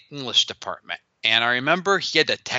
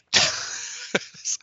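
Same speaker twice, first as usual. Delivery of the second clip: short airy laugh, high and voiceless; quick unvoiced self-mocking chuckle; breathy laugh; 3 dB quieter than usual. Real, not acted. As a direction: laugh at himself, breath only. Laughs at calling himself idiot.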